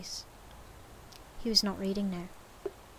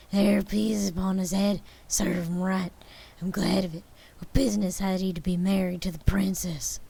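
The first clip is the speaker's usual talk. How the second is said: loud, gleeful storybook recall; excited old Scrooge; 6 dB louder than usual. A narrator's reading. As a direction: rattle on like a thrilled old man. Scrooge voiced, excited memories.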